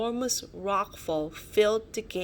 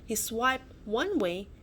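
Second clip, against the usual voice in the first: usual speaking voice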